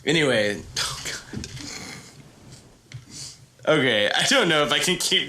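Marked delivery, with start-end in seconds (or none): 0.8-1.5 breathless whisper; whispered oh God. Real, not acted. Tired storyteller whispering to himself. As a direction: sigh it under your breath.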